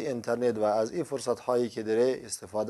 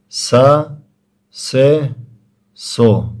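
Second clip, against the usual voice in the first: loud, measured letter-by-letter recitation; syllable drill recitation; 13 dB louder, much slower than usual. Shown as a demonstration. Quran teacher sounding out letters with short vowels for learners, drill recitation. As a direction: sound out each syllable firmly, pausing after each.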